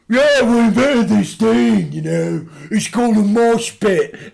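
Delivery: high, slow mocking voice of a young interviewer bloke; hammed-up impression of a clueless kid. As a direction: sneer it out in a silly high voice. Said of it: "And he says".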